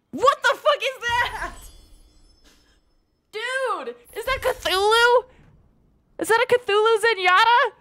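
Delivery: high excited disbelief; amazed. Real, not acted. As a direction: gasp at something incredible. Amazed by a new skin.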